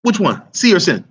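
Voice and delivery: ordinary talk, nothing marked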